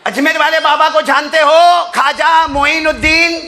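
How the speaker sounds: preacher calling out to the hall; rising preaching. Acted. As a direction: pull the crowd back in. Preacher rallies crowd.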